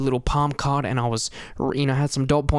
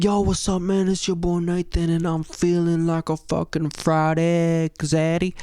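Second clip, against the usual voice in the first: high energy hype; 3 semitones higher, slower than usual. Retold or imitated. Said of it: "instead of going up with that energy".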